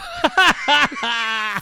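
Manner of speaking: cracking up at his own story; big high laugh. Real, not acted. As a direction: burst of high, loud laughter.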